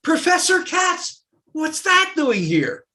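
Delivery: high, loud, slow astonished question; a student stopping short at the wall; curious student. Retold or imitated. Student in the office, loudly amazed at the symbol.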